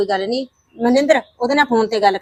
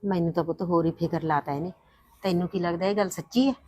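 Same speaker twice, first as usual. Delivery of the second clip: hushed worry; 4 semitones lower, 13 dB quieter than usual. Acted. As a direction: ask it under your breath. Worried mother talks privately. (ਹੌਲੀ ਫ਼ਿਕਰਮੰਦ ਆਵਾਜ਼)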